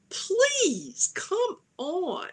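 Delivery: high-pitched, exasperated squeal of disbelief; exasperated plea. Acted. Fan protesting Krypto jumping on wounded Superman, exasperated plea. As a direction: plead way up high, fed up.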